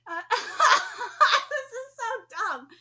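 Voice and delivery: loud, slow laugh through the words. Real, not acted. Reader of the letter cracking up, laughing loudly. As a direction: crack up at how silly it is.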